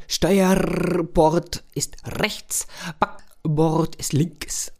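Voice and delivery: slow, deliberate memory rhyme; slow mnemonic. Acted. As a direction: spell it out slowly like a rule to remember. Mnemonic recited slowly.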